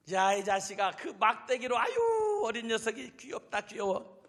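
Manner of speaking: lightly voiced condescending scoff; giant's mockery. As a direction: laugh off the boy as cute. Giant mocks the boy.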